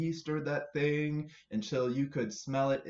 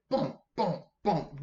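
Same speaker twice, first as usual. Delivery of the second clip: bump sounds; 5 semitones higher, 4 dB louder, slower than usual. Acted. Toad landing on head.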